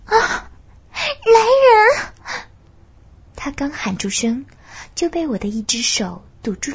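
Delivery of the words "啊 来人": high, loud terrified scream; screaming for help. Acted. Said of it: "她尖叫了起来".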